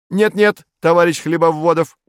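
high, loud, urgent objection; objecting. A narrator's reading. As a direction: cut in to protest, pitched high. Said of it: "возразил Фарфуркис". (возражая, высоким громким голосом)

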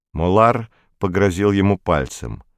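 ordinary delivery

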